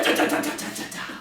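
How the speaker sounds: clipped unvoiced da-da rattle of a shaking plane; imitated rattling. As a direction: mimic the airframe judder.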